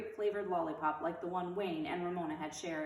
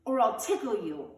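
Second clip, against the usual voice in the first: threatening; 3 semitones higher, 8 dB louder than usual. Acted. Triumphant threat found.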